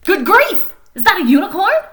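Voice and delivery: high, loud astonished shriek; surprised shriek. Acted. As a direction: shriek it like you can't believe your eyes. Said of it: "he shrieked in great surprise".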